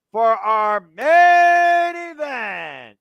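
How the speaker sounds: slow, shouted main event announcement; announcer shout. Real, not acted. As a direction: stretch it out like a ring announcer.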